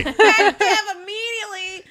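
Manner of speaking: laughing so hard the words barely hold; cracking up mid-phrase; laughing echo. Real, not acted. Laughing while echoing a coworker.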